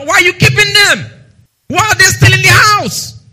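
high, loud rhetorical challenge; preaching shout. Real, not acted. Preacher challenges the church with a loud question. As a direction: hammer the question home.

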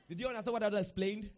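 ordinary talk

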